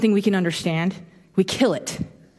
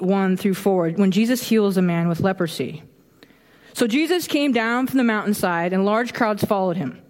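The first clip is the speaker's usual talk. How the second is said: usual speaking voice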